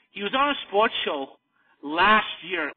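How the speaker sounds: ordinary talk, nothing marked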